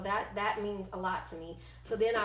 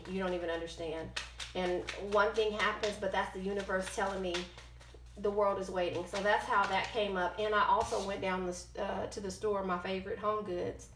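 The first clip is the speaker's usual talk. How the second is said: ordinary talk, nothing marked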